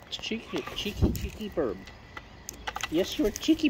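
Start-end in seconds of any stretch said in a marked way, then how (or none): none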